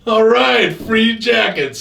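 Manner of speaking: loud, high whoop of joy; delighted cheer. Retold or imitated. Wife hears the jackets are from D J, delighted. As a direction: stretch it out in a big imitated cheer.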